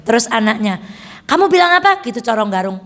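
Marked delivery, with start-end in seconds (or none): 1.3-2.0 sharp demand. Acted. Pharaoh's daughter confronts servant.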